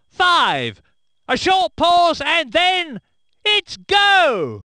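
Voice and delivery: countdown rising to an excited go; building start call. Acted.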